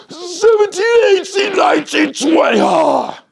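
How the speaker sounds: high, loud strained counting shout; straining counting. Retold or imitated. Mocking a straining breather: high, loud strained count. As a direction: count like you're bursting for air. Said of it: "If you're straining, going".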